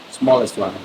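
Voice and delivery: usual speaking voice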